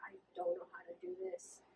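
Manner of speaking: hushed inner thought. Retold or imitated. Telling a friend her private doubt, near-voiceless. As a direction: breathe it like a secret worry.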